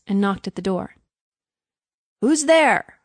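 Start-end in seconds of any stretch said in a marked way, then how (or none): 2.2-3.0 high, loud questioning call; raised voice demanding who knocks. A narrator's reading. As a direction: shout it towards the door. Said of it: "cried the doctor".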